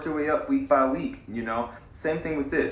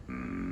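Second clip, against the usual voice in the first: low creaky hum on M; fry gently through a closed mouth; hummed fry; 10 dB quieter than usual. Shown as a demonstration. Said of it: "if you do an M".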